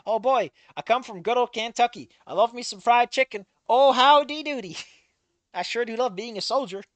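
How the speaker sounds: a hint of a Kentucky soldier's Southern twang; Southern accent. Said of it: "This is Officer Newbie".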